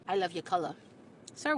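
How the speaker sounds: usual speaking voice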